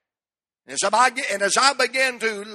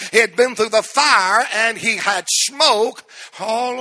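insistent pulpit exhortation, raised voice; forceful preaching; 4 semitones higher, 5 dB louder than usual. Real, not acted. Preacher warns congregation to leave bitterness behind, forceful preaching. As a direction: preach it hard, driving each phrase louder.

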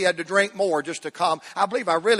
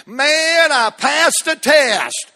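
higher, louder mock brag; mock bragging; 9 semitones higher, 6 dB louder, much slower than usual. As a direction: show off like a smug saint. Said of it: "parade around heaven going".

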